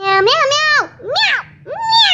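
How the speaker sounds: high pretend cat meows; kitty calling from up high; cat meowing.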